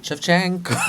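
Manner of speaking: ordinary delivery, nothing marked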